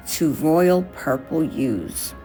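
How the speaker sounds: usual speaking voice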